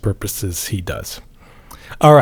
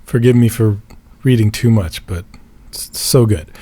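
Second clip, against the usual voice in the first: ordinary talk, nothing marked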